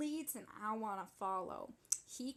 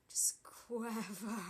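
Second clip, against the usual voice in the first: drawn-out breathy whisper, savoring it; slow whisper; much slower than usual. Acted. Tina savoring the moment in a slow whisper. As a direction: stretch the word out in a hush.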